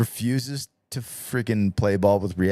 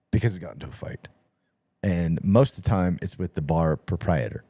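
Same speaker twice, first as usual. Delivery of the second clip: usual speaking voice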